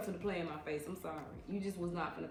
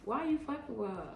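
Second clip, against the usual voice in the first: mimicking her own comeback; 4 semitones higher than usual. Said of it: "I'm like".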